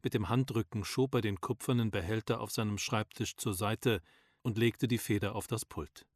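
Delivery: plain reading voice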